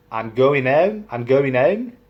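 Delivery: "home" without its H, rising at the end; West Country dropped H. Shown as a demonstration. Vlogger showing West Country speech, H dropped in "home". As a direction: say "home" with no H, as "ome". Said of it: "they don't even know what H is".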